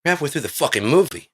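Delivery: high, loud mock outrage; exasperated high-pitched objection; indignant protest. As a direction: complain like an outraged moviegoer. Voices protesting audience.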